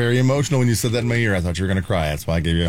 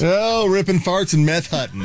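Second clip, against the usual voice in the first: laughing shout; 7 semitones higher than usual. Real, not acted.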